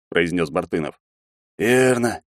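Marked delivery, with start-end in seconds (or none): none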